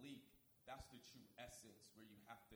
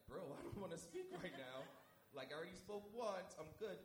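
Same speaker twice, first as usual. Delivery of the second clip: higher, louder pleading refusal; protest, a little louder; exasperated protest; 6 semitones higher, 6 dB louder than usual. Retold or imitated. Retold refusal to the Spirit.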